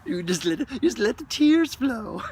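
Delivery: hammed-up weepy voice; mock crying. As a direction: play a teary breakdown, hammed-up. Mock weepy tear release.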